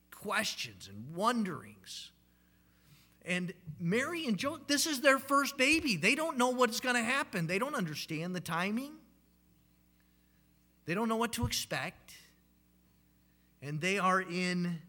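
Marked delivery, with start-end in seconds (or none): 3.3-12.1 rising intensity. Acted. Preacher builds Mary and Joseph scene.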